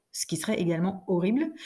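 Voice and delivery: ordinary talk, nothing marked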